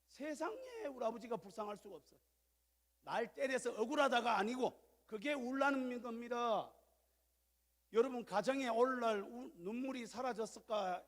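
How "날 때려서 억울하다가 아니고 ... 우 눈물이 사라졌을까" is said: higher, louder, slowed emphatic preaching; fervent preaching. Acted. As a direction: drive the point home to the church. Preacher's full-force sermon. (힘주어 외치듯 설교)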